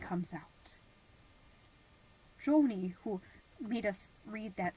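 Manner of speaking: hushed whisper after the climax; whispered reveal. Acted. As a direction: breathe the reveal. Hushed after dramatic pause.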